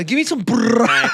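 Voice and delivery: "burrata" with an absurdly long rolled r; mock Italian pronunciation. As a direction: order it, trilling the r of "burrata" hugely. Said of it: "it's not burrata".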